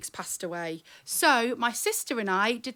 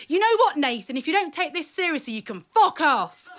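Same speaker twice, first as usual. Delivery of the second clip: imitating an angry five-year-old's outburst, loud and higher; mad little sister; 5 semitones higher, 7 dB louder than usual. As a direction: act out a little sister's foul-mouthed tirade, slowing on the last words. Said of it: "my little sister went mad".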